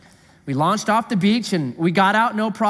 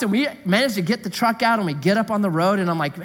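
normal talking voice

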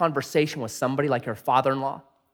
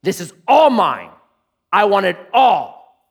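greedy Laban; 4 semitones higher, 6 dB louder, slower than usual. Pretend. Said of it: "Jacob is dead".

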